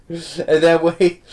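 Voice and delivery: ordinary talk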